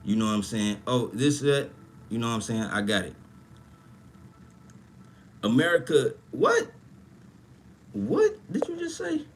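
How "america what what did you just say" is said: stunned disbelief. Real, not acted. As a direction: voice shooting way up in shock. Reacting in disbelief to a chat comment.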